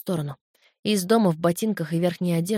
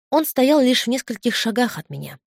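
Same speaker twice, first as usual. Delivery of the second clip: ordinary delivery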